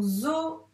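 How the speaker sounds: "zoo" slowly as zo-o; syllabes détachées. Shown as a demonstration. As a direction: separate the two vowels of "zoo", long Z first. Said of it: "il faut que tu tapes les syllabes". (« zoo » découpé en zo, o)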